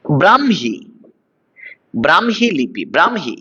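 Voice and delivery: "ব্রাহ্মী" as bram-hi, the h sounded after m; correct pronunciation. Shown as a demonstration. Teacher shows students how the word goes, correctly pronounced. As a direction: model "ব্রাহ্মী" clearly, bram then hi. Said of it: "এই শব্দটার উচ্চারণ হচ্ছে এটা".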